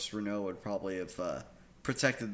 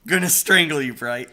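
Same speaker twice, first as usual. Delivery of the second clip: mock threat; 5 semitones higher, 12 dB louder than usual. Mock threat at fellow player.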